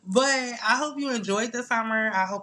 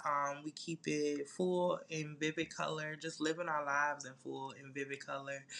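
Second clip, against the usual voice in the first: soft slogan intro; 4 semitones lower, 6 dB quieter than usual. Acted. Soft slogan-style show intro.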